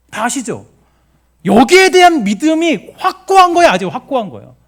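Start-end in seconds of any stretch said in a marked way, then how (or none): 1.4-4.5 very loud, high-pitched emphasis; impassioned preaching. Real, not acted. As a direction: cry out the certainty at full force. 확고한 믿음을 소리 높여 강조. (목청껏 높여 강조하는 설교조)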